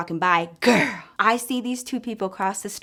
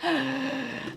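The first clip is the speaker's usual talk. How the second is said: gasp; 5 semitones higher, 8 dB quieter than usual. Retold or imitated. Hearing gossip from coworker.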